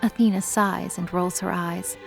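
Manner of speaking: normal talking voice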